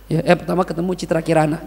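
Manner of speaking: ordinary talk, nothing marked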